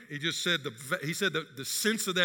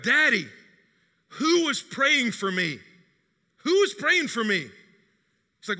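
higher, louder, pressing question; urgent pleading question; 5 semitones higher, 3 dB louder than usual. Retold or imitated. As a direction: demand to know who prayed. Daughter urgently asking father.

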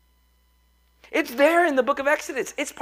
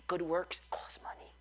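hushed conspiratorial whisper; 6 semitones lower, 16 dB quieter than usual. Acted. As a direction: lean in and whisper it. Aside about money, whispered.

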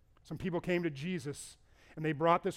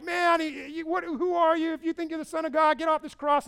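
higher, louder taunting sneer; mocking; 9 semitones higher, 6 dB louder than usual. Criminal taunting Jesus on the cross, higher and mocking. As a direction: jeer at him from the cross. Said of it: "he's just mocking Jesus".

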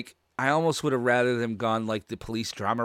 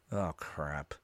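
hushed dread; 5 semitones lower, 8 dB quieter than usual. Retold or imitated. Retelling his dread at the scene.

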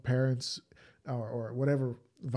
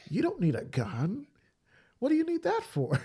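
mocking Bill Maher, rising much higher on the question; condescending mock Bill Maher; Bill Maher impression; 13 semitones higher than usual. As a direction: scoff like Bill Maher dismissing gun owners. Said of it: "I'm doing my best Bill Maher impression".

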